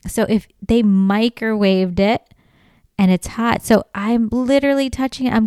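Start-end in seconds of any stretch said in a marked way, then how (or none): none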